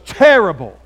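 a hint of the young man, loud and falling; retold glum answer. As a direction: blurt it out then let it drop.

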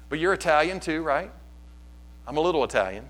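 ordinary talk

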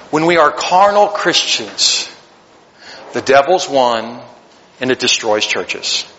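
grave conclusion; preacher warning the church, slow and grave; solemn warning. Acted.

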